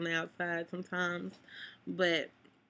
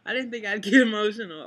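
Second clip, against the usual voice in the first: a loud embarrassed laugh breaking into the words; real teary laugh caught on camera; laughing through tears; 10 dB louder, faster than usual. Real, not acted. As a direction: laugh it off while fighting tears. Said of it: "I really feel emotional right now".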